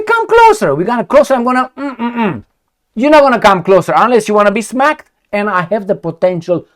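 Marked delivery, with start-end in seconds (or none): 0.0-2.4 mock menace. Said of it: "I say".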